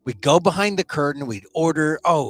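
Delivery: ordinary talk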